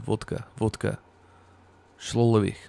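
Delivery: put-on trying out a Russian accent. Streamer warming up before reading aloud, Russian accent. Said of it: "Ignore the stupid Russian accent".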